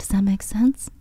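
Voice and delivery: breathy whispered teacher check-in; whispering. Retold or imitated. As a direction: whisper it gently to the class. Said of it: "I do that when I'm teaching".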